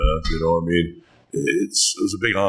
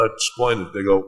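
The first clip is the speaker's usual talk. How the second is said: ordinary delivery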